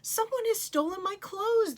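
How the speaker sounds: high, loud distressed cry. Acted. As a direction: cry out in dismay. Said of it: "the young man cried".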